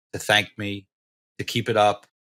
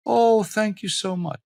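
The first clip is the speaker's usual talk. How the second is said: lightly voiced old lady, high and slow; a sweet elderly shopper thanking a stranger; high, slow, grateful old-lady voice; 9 semitones higher, slower than usual. Grateful senior voiced in story.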